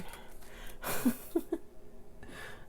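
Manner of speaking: long breathy giggle; soft giggle. Real, not acted. Liking the next position, soft breathy giggle. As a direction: let a quiet giggle escape. Laughing at a position she likes.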